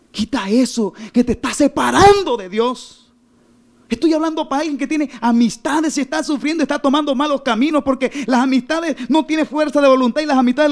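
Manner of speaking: high, urgent exhortation; full-force preaching. Real, not acted. Preacher urges someone to leave bad friendships, full-force preaching. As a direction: drive each plea home at sermon pitch.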